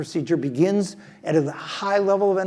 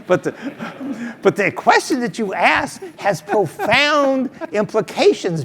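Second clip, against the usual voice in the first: real laughter breaking through, higher and louder; laughing; 7 semitones higher, 6 dB louder, slower than usual. Real, not acted. Speaker to the interviewer, amused at his own answer, laughing. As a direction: laugh through the words, a bit slowed.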